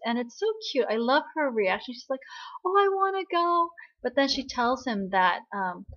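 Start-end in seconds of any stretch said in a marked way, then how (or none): 2.6-3.7 high, bright-eyed eagerness; imitating an eager girl, higher. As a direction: jump at the offer, excited. Gong Sil's eager reaction, cute.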